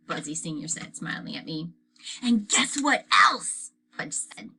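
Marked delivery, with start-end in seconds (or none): 2.2-3.7 put-on 1 little boy voice. A narrator's reading. Fudge voiced by narrator.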